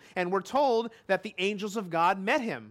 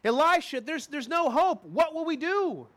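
panicked servant; 6 semitones higher than usual. Acted. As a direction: cry out to Elisha in panic. Servant panics under siege.

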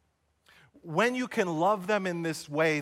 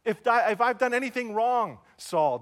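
higher, louder plea to Saul; calling out; 6 semitones higher, 4 dB louder than usual. Retold or imitated. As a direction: call across to an enemy, urgent and raised. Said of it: "David calls for Saul and says".

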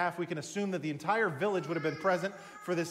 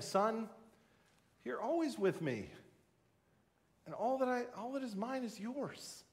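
tender plea; 4 semitones higher, 5 dB quieter than usual. Acted. The father pleads with his son.

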